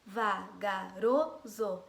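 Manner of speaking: "Vagaroso" very slowly in separate syllables, RO strongest; stress on RO. Shown as a demonstration. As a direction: stretch out "Vagaroso" and lean on RO. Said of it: "É o ro".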